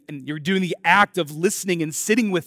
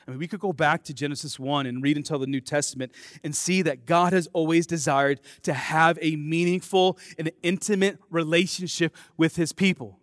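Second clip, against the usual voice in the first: ordinary talk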